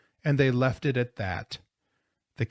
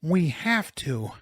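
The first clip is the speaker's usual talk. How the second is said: slow, higher, effortful reply; panting; 5 semitones higher, slower than usual. A narrator's reading. Mrs. Zimmerman urging tired companions on, panting. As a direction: push the words out while winded. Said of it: "panted Missus Zimmerman".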